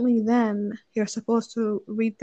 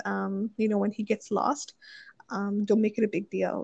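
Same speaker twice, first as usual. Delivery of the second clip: ordinary talk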